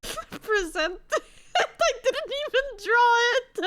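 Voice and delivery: laughing through the words; cracking up while confessing. Real, not acted. Admitting mom drew his project.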